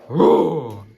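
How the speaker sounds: loud shouted dinosaur roar. Shown as a demonstration. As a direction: give a big monster roar. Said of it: "Yeah, do it nicely then".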